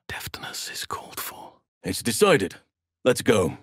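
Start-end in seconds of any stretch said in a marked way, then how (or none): 0.1-1.5 hushed careful whisper; quiet stealth whisper. Acted. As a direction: breathe it while sneaking. Stealth line in game.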